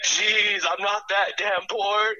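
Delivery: high-pitched mock exasperation, drawn out at the end; imitating his uncle. Nephew retelling his uncle's reply about video messages, mock exasperated. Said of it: "it just how he says it".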